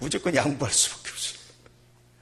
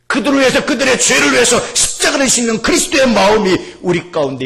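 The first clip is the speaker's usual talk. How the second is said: loud, raised, impassioned blessing; full-force preaching; 10 semitones higher, 9 dB louder than usual. Real, not acted. Preacher blessing congregation with Christ's heart, impassioned. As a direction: proclaim it with full fervour.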